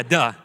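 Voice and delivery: "duh" for "the", hard d; "duh" said firmly with a d for "th"; child's mispronunciation. Retold or imitated. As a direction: confirm "duh" as if it were a real word. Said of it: "his enunciation and pronunciation has not caught".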